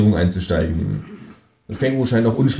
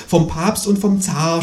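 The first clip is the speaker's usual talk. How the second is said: higher, drawn-out moan; lightly acted, slow groan of woe; groaning ghost; 9 semitones higher, slower than usual. A narrator's reading. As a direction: moan the names slowly. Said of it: "stöhnt das Gespenst".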